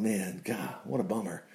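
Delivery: low, soft, deflated aside; dejected muttering. Acted. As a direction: mutter it to yourself, let down. Imagines dejected Isaiah walking out.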